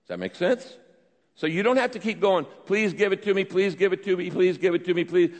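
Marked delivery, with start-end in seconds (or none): 2.6-5.3 higher, insistent repeated pleading; hammed-up begging on a raised, repetitive note; mock begging. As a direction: imitate someone pestering with the same plea over and over. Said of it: "like you're begging God".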